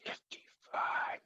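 slow, breathy whisper of a dead man; whispery raspy ghost. Retold or imitated. Ghost telling his age on the recorder, slow whisper. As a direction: breathe it out slowly like a voice from the grave. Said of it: "In a whispery, raspy voice".

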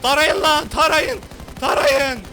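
loud, high shout. Real, not acted. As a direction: yell the order to shoot. Shouting to shoot. (bağırarak emir)

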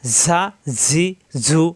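ঝা, জি, ঝু as buzzing "zaa, zee, zoo"; pressed whistling z. Shown as a demonstration. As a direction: separate whistled z syllables ঝা, জি, ঝু. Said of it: "নিচে দাঁতের আগায় লাগবে".

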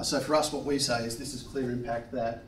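ordinary talk, nothing marked

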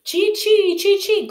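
high, loud chee-chee of a grinder; repeated high-pitched machine chirps; imitated grinder noise. A narrator's reading. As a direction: squeal like a mill grinding. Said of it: "Condi could hear the grinder".

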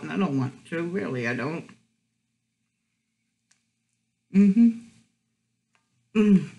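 chewing between words; talking around food; mouth full. Real, not acted. Seen 0: holds sandwich, lips closed and moving between bites throughout.

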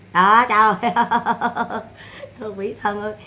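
drawn-out laugh through the words; real amused laugh, slowly stretched; chuckling at the sun in her eyes. Real, not acted. Laughing at the glaring sunlight.

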